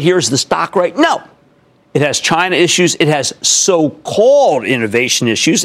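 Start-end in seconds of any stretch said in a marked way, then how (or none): 3.4-5.6 SO-CALLED drawn out slowly and punched; stress on SO-CALLED. Real, not acted. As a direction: lean hard and long on "so-called". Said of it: "emphasis on so-called".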